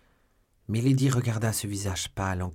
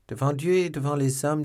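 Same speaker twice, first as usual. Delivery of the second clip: higher, louder solemn oath; raised solemn oath; 7 semitones higher, 6 dB louder than usual. A narrator's reading. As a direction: swear it before God, raised and firm. Baron swears his accusation.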